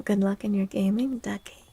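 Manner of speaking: ordinary delivery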